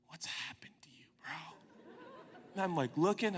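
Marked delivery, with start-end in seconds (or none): 0.1-1.9 soft whisper at the mirror; whispering to himself. Retold or imitated. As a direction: breathe it to your own reflection.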